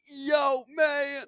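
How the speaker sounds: high, drawn-out rapper impression. Host demonstrating how the rapper sounds, high and slow. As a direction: stretch the words in a put-on rapper voice. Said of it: "Jay-Z impression".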